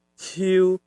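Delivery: ciu level at mid pitch; beard tone. Shown as a demonstration. Teacher recaps three tones, ciu smooth and level. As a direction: hold ciu on one even note. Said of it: "Agak halus".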